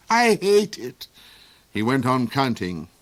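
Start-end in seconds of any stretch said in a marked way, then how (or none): 0.1-1.1 high, louder villain snarl. A narrator's reading. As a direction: spit out the hatred. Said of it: "he snarled".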